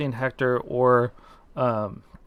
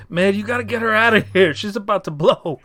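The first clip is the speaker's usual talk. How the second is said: higher, louder urgent warning; 6 semitones higher, 4 dB louder than usual. Acted. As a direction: warn them the room is about to blow. GM voices Hothead urgently.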